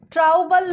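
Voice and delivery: ट्राउबल said with an ow vowel; ट्राउबल as trow-bal; mispronunciation demo. Shown as a demonstration. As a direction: wrongly sound both O and U in ट्राउबल. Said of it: "नहीं बोलते हैं इसको".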